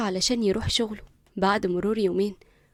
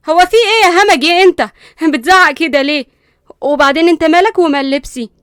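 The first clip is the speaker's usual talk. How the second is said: much louder, high indignant yell; shouting back; 7 semitones higher, 13 dB louder than usual. Acted. Linda yelling at Qusay for shouting, indignant. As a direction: fire right back at him, outraged.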